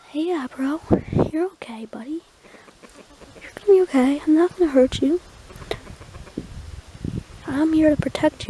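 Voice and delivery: soft, soothing words to a nervous hen, dropping to a whisper at times; calm voice. Shown as a demonstration. Owner soothing his hen Abro, soft calm whispery voice. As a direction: gently reassure a frightened animal. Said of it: "we're gonna talk to her in a very calm voice".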